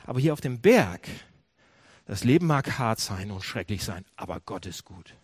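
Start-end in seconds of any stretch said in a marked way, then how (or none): 2.0-5.2 calm assurance. Acted. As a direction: settle it gently, like a settled truth. Joseph's hilltop view, softly voiced.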